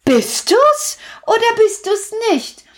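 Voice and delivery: put-on high, bright children's call; children shouting across the street. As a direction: call it out like excited kids. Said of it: "riefen die Kinder".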